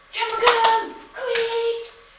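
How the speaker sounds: high, loud cheering at the dog; enthusiastic recall. Shown as a demonstration. Trainer showing owners how to call the dog, high and loud. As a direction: gush with praise like a cheerleader. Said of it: "I'm giving him a jackpot".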